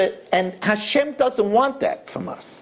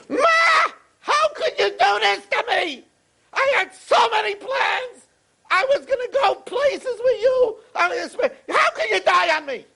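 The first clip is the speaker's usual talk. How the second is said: high-pitched anguished cry, imitated; screaming in grief; 13 semitones higher than usual. Son at the coffin screaming to his mother, imitated anguish. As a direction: wail it high like a bereaved son. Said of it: "started screaming".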